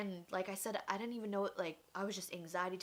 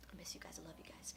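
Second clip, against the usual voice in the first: much softer, whispered goodbye; 2 semitones lower, 13 dB quieter, faster than usual. Real, not acted. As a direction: whisper it to absent friends. Message to old coworkers.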